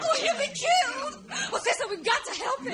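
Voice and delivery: high, fast frightened pleading; panicked. Acted. Panicking for Tarzan.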